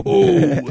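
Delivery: loud, high-pitched "ooh"; mock high whoop. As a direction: hoot it out, imitating a mocking reaction. Imitates Denzel's whooping reaction.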